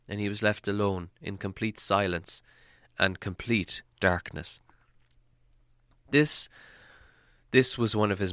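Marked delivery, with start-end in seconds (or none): none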